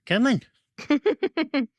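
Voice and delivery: very high, drawn-out singsong call. Real, not acted. Trainer luring the dog to play, very high singsong. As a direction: sing it up high to lure the dog.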